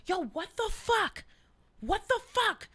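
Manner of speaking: high-pitched, exasperated imitation of a gamer; mock outraged gamer. Son yelling at players in the game, mock outrage. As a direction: protest loudly as if wronged mid-game. Retold voice overheard during gaming.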